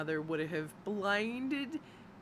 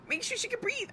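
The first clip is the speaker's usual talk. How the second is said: the same plea pushed much higher, near squeak; shrill mock panic. Mother retelling her fear at the pool, peak mock panic. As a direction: panic climbing to the top of the voice. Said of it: "I'm, like, fucking panicking".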